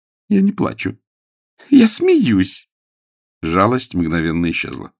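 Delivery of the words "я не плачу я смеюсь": put-on very high young lady's voice, getting louder; defiant Jane. Jane denies crying in the cupboard, defiantly. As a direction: protest indignantly.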